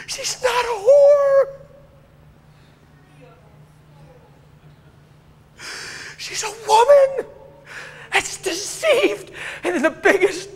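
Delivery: high, loud tearful preaching; real tearful outcry; crying while preaching. Real, not acted. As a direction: preach through real tears.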